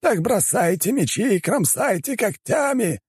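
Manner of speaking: high, strained, drawn-out shout; furious commanding screech; exasperated yelled order. Acted. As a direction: yell at bungling underlings. Elder yells orders at vampires.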